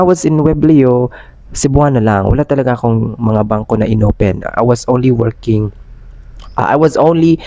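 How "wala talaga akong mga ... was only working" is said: ordinary talk, nothing marked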